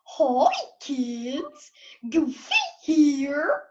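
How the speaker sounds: slow cartoon-dog voice with big upward swoops; drawn-out, swooping cartoon character voice; Goofy. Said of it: "Mine is Goofy".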